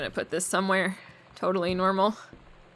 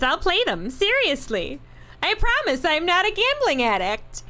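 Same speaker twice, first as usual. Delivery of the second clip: letter read in a put-on Barb voice, a bit higher; Barb's accent; 5 semitones higher than usual. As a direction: play the chatty friend Barb writing a letter. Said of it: "I'm gonna do this in Barb's accent".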